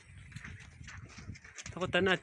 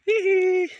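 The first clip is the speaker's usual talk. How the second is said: high giggle; 8 dB louder than usual. Real, not acted. Giggle between lines.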